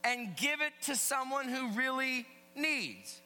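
high, loud sermon voice; emphatic preaching. Real, not acted. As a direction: preach it to the whole room with punch. Preaches at full voice.

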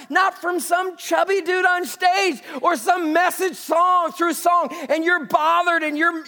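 loud, high preaching voice; full-force sermon delivery; fervent preaching. Acted. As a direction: drive it home from the pulpit.

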